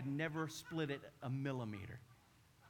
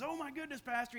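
alarmed neighbor; 10 semitones higher than usual. Retold or imitated. Neighbor voiced in story.

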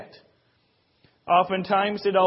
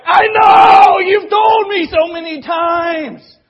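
hammed-up fed-up yell, much higher and louder; annoyed listener mocking, loud exasperated outburst; exasperated shout; 12 semitones higher, 16 dB louder, slower than usual. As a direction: snap back at a broken-record friend. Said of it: "And people are like".

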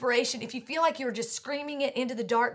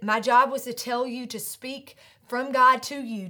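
stern proclamation; 3 semitones higher, 7 dB louder than usual. Acted. Angel rebukes Zechariah.

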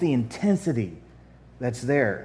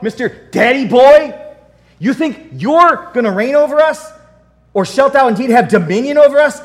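high, loud, sneering taunt; mocking brothers; 9 semitones higher, 11 dB louder than usual. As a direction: taunt him like jealous brothers. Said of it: "They're like".

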